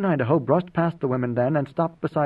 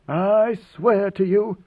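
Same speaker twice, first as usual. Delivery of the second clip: slow, higher, deliberate oath; icy disdain; 5 semitones higher, 4 dB louder, much slower than usual. A narrator's reading. God Emperor threatens a foolish envoy, icily disdainful. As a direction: weigh each word with cold disdain. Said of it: "speaking in his icy voice of disdain".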